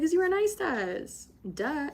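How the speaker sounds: high, exaggerated stating of the obvious; playful "duh" tone. Retold teasing answer to bartender.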